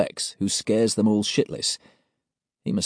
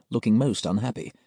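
ordinary delivery, nothing marked

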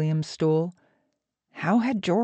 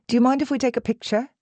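quick, higher Australian fan voice; Australian accent; 6 semitones higher, much faster than usual. A narrator's reading. Fan asks the actor for a photo, quick Australian accent. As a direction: ask it fast and high, with an Australian lilt. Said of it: "Her accent may be Australian".

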